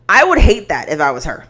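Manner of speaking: usual speaking voice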